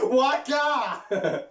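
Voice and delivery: high, loud drawn-out holler; daft shout. Pretend. Joking to mate about Shane Duffy, high silly shout. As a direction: blurt it out as a silly yell.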